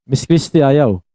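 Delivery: ordinary talk, nothing marked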